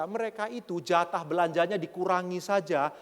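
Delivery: ordinary talk, nothing marked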